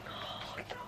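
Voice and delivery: tearful whisper to herself, fighting not to cry; holding back tears. Real, not acted. Reader tearing up over a journal entry, whispering through tears. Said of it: "I'm literally crying".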